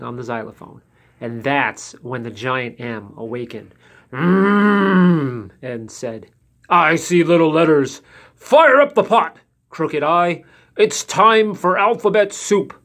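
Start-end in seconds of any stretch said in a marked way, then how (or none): 4.1-5.5 groggy giant hum; giant waking. A narrator's reading. Giant wakes. 6.7-12.7 loud booming giant voice; menacing giant. A narrator's reading. Giant M threatens letters.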